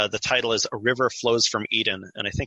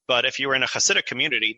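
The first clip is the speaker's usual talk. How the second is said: ordinary talk, nothing marked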